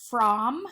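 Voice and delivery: "from" with an ah sound, like "frahm"; sounded-out mispronunciation. Shown as a demonstration. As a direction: say "from" with a short O, as in Tom. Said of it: "instead of making an ah sound".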